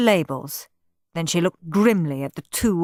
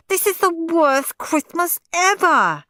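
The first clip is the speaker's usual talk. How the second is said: whiny wail; 11 semitones higher, 3 dB louder than usual. Acted. Steve wailing.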